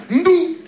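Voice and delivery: high, loud "Ndou" like a drum hit; name as a sound. Shown as a demonstration. Comedian voicing the cousin's name as a loud high sound. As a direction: pop it out as a noise. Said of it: "C'est un son, le truc".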